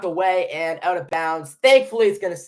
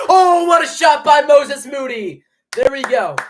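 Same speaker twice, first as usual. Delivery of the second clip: excited shout; 11 semitones higher, 11 dB louder than usual. Real, not acted. Fan celebrating Moody's tough shot, excited shout.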